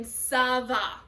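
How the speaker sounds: "ça va" slowly, with an S sound to start; C with cédille as S. Shown as a demonstration. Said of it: "That C with the cédille makes a S sound".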